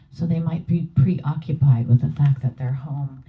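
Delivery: usual speaking voice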